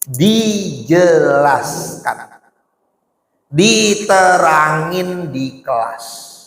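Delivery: very slow, drawn-out point; slow emphasis. Real, not acted. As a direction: stretch each word to make it sink in. Repeats the lesson slowly.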